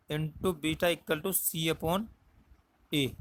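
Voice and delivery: "into beta equal to C upon" spelled out very slowly, word by word; slow it down so students can write it; slow dictation. Shown as a demonstration. Teacher dictating formula to students, very slow. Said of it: "क्या होता है, C upon A".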